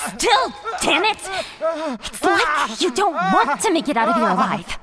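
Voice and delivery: sharp exasperated shout at the captive; angry scolding. Acted. Captor yelling at her captive for failing, angry shout. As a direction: yell at him for failing.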